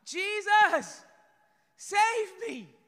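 high, loud, pleading shout; desperate cry for help. Retold or imitated. Peter sinking in the waves calls to Jesus, a loud desperate plea. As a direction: cry out like a man who is sinking. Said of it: "And he cries out".

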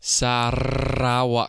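"Sarawak" with a long trilled rolled r, slowly. Shown as a demonstration. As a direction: roll the r in "Sarawak" hard and slow. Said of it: "[name]示范一下".

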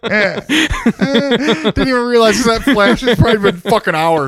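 slow, high words through laughter; laughing. Real, not acted. Laughing while telling the story.

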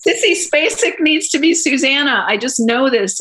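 high-pitched, re-enacted burst of conviction; excited insistence. Retelling to Paul her sudden casting idea, excitedly insistent. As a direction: insist on it, pitch jumping well up.

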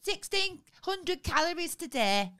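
loud, nagging impression of the missus; impression of Sarah. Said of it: "That's my impression of Sarah".

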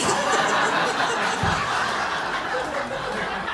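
long high-pitched unvoiced laugh. Real, not acted. Host laughs at surprise.

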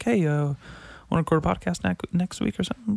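a hint of an eager buddy asking; casual ask. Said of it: "Just be like".

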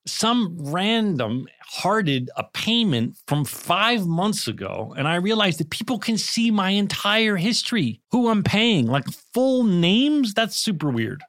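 high, slow, emphatic complaint; ad skit rant. Acted. Friend complaining about pay apps in an ad, mock exasperated. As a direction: gripe in a scripted, punched-up voice.

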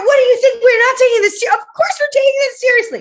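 high, loud mock indignation; indignant protest. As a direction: protest like you're offended. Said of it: "we were like".

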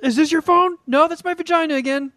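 high, hammed-up put-on voice; mock girl's voice. Co-host acting out phone search, high mock distress. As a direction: play it high, ending in a mock cry.

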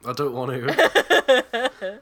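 put-on drawn-out childish whine; whiny refusal. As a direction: stretch it out like a sulky kid. Refusing squirty cheese, drawn out.

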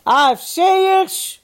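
high, loud, drawn-out address; calling to the room. A narrator's reading. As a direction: stretch out the call for attention. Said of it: "begon hij opnieuw".

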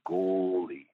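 "goalie" with a long stretched first vowel; "goalie" drawled slowly on the O; drawn-out nickname. Retold or imitated. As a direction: imitate the boss stretching "goalie" into "goooalie". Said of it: "he said like that".

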